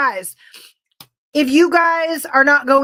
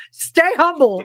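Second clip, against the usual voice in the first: mock motto; 4 semitones higher, slower than usual. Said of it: "here to remind me to stay humble".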